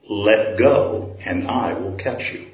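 low, slow and soft; the Lord's calm voice. Acted. The Lord tells the man to let go, low and slow. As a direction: reassure him slowly from above.

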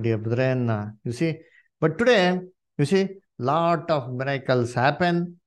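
ordinary delivery

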